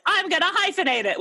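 a hint of a defiant child declaring it; mock twelve-year-old. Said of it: "I was like".

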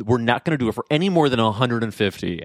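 ordinary talk, nothing marked

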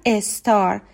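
استار with an added e before the s, "es-tar"; Persian learners' mispronunciation. Retold or imitated. Teacher imitates Iranians saying star, mispronounced with leading e. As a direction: put a short e in front of the s in استار. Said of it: "قبل از اس یک ای هم".

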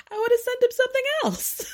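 high-pitched, words through real laughter; laughing. Real, not acted. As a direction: crack up while delivering the retold punchline. Cracks up retelling a joke.

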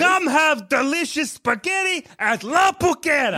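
high mock-Italian sales pitch; hammed-up Italian restaurant-ad voice; Italian pizza guy. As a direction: sell it in a cartoon Italian accent. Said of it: "They all have the same voice".

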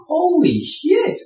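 awed swear. Retold or imitated. Sailor in the dark storm, stunned and awed. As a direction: breathe it out in disbelief. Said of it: "looking around going".

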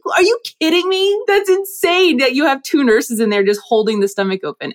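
high-pitched disbelief, easing down toward the end; incredulous reaction. Retold or imitated. Reacting to nurses holding a stomach open, incredulous. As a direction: retell it as a stunned, high-voiced outburst. Retold shocked reaction to surgery practice.

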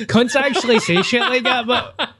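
high, laughter-filled voice; laughing while talking. Real, not acted. Host cracking up at what locals actually say, laughing. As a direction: talk through helpless giggles.

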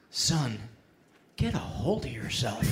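a fifties stranger talks him down, low pity; pitying old man. Retold or imitated. Stranger in fedora scolds raging driver, low pitying voice. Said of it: "with a mixture of disbelief and pity says".

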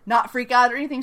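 usual speaking voice

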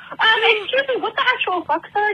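high-pitched retold indignation; mildly hammed-up, high-pitched incredulous protest; indignant disbelief. Patient disputing the dentist's denial, indignant.

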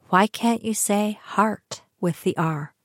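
normal talking voice

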